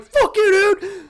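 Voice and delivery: very high, very loud cry half laughing; mock anguished fury. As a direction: howl it in hammed-up grief. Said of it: "And Grievous is like".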